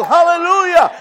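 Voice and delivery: high, loud burst of praise; real, full-voiced preacher's hallelujah; exultant shout. Real, not acted. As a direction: shout it out at the peak of the sermon. Preacher exclaims at climax.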